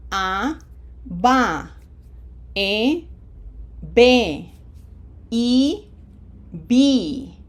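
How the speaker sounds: "A, ba, E, be, I, bi" with pure Spanish vowels, carefully separated; "A, ba, E, be, I, bi" as ah-bah, eh-beh, ee-bee; vowel-to-syllable demo. Shown as a demonstration. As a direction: carry each vowel into its syllable: "A, ba, E, be, I, bi". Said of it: "how to map the sound".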